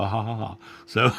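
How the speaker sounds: ordinary talk, nothing marked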